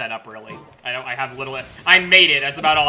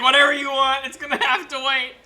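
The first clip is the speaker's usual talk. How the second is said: imitating Itto; 7 semitones higher than usual. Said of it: "he's like".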